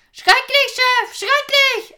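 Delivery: high, frantic lookout's cry; agitated shouting. Acted. Lookout shouting to the chief, high and frantic. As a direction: yell it down from the mast in alarm. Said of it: "das aufgeregte Geschrei Gorms".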